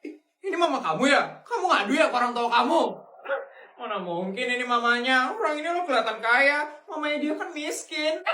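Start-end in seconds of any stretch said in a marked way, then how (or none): none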